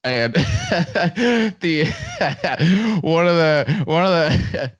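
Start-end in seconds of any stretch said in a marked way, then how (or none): 0.4-1.6 high burst of real laughter. Real, not acted. Laughing while telling story. 1.9-3.0 another high laugh breaking in. Real, not acted. Laughing while telling story.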